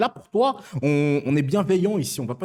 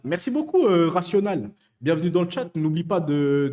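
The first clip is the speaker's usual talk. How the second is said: ordinary talk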